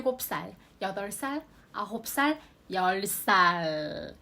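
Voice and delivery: 열 살 drawn out very slowly; slow counting. Shown as a demonstration. Teacher finishes the count for students, very slow. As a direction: stretch out the last count for learners. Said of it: "こういう数字をつけるのを気をつけて".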